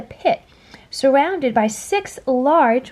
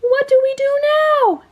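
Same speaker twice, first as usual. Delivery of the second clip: very high, loud frantic squeal; panicked earthworm; 5 dB louder than usual. A narrator's reading. Earthworm panicking as the shark bears down. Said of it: "shrieked the earthworm".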